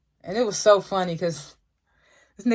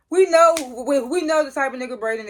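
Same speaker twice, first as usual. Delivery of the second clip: higher, louder outburst; exasperated; 7 semitones higher, 4 dB louder than usual. Real, not acted. Vlogger fed up with Braden, loud exasperated outburst. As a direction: throw it out, fed up.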